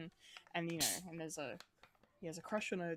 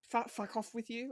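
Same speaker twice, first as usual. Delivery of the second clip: upper-class English, a bit higher; posh voice; 4 semitones higher than usual. Retold or imitated. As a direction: sneer it like a posh lord. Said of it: "like, in this posh voice".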